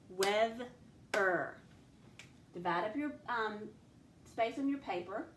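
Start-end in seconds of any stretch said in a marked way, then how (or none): 0.1-1.6 "weather" in two separate chunks, "weth", pause, "er"; split into syllables. Shown as a demonstration. Teacher showing pupils the chunks, "weather" split in two. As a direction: break "weather" apart between its syllables. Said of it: "let's look at that first syllable".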